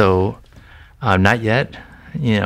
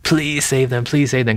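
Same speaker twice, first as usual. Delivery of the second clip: mock begging prayer, pitched up; pleading; 4 semitones higher than usual. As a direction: imitate someone pleading with God. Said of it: "I'm pleading with God".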